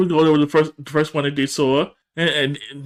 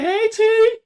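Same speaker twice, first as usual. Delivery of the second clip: very high singsong greeting; mock flirty hello. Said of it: "She's like".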